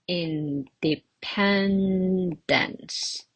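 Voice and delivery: every syllable drawn out; teacher's slow-motion model; sounded out very slowly. Shown as a demonstration. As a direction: stretch the word out syllable by syllable. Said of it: "How many syllables are there?".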